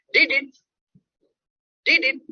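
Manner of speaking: "Did it?" slurred into one quick unit, high; run-together American reduction. Shown as a demonstration. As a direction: squash "Did it?" together, pitched high, so it is hard to recognise. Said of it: "you will not recognize that what I am saying".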